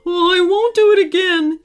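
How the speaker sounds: loud high panicked shout; frightened shout. A narrator's reading. Burglar fleeing the werewolf, loud high shout. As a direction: yell the promise as you flee. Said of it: "he shouted".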